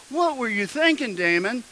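high-pitched, lightly voiced scolding mom; imitating mom. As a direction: mimic a mother's exasperated question. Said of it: "I can hear my mom saying".

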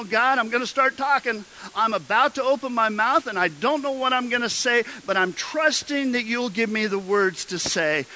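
high, urgent prayer; fervent prayer. Acted. Believer trusting God for words to say, fervent prayer.

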